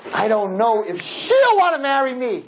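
put-on nervous boy doubting the marriage; lightly voiced nervous groom. Groom telling the rabbi he may change, hesitant. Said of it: "what would we say?".

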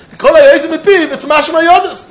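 high, insistent exclamation; animated argument. Retold or imitated. Teacher voicing the Ran's reasoning to students, animated and insistent.

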